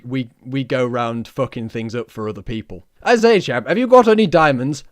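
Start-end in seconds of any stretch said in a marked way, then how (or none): 3.0-4.8 high, loud toff caricature; booming mock-imperial Englishman; British colonial chap. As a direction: hail them as a hammed-up British gent. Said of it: "the British, uh, we".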